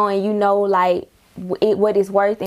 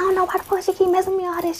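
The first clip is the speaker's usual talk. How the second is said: high, rapid re-enacted protest; retold exasperated complaint; 11 semitones higher, faster than usual. Pleading her case to the warden, retold exasperated complaint. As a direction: rattle off the retold grievance at a higher pitch.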